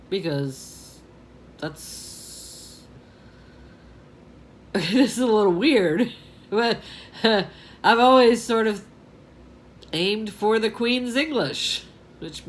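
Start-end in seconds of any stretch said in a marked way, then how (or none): none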